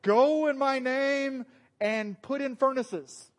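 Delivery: loud, high, slow mock proclamation; commanding leader. Preacher acting out Pete sending workers out, mock command. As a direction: proclaim it grandly, stretching the words. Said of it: "If Pete was like".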